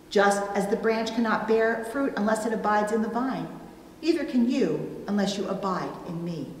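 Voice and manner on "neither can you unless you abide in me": usual speaking voice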